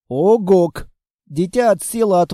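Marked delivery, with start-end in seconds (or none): 0.1-0.9 a short, loud creature grunt; monster grunt. Acted. The monster grunts at the reading girl. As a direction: bark it like a beast.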